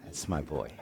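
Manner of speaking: murmured softly, a private proud aside; quiet pride. Retold or imitated. Father hearing his son repeat his joke, quiet pride. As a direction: say it under your breath, pleased. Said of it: "and I was like".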